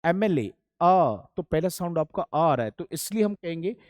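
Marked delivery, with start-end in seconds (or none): 0.0-1.2 एमएलए slow and loud, leaning on the opening अ; loud, slow एमएलए, then the bare अ; sounding out MLA. Shown as a demonstration. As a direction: stretch एमएलए to show the first अ sound. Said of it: "इसका उच्चारण तो करके देखिए जरा".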